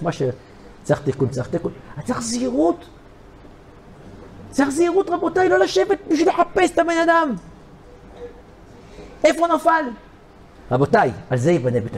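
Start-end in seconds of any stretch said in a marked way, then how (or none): 2.0-9.8 fervent warning. Acted. Preacher warning the congregation.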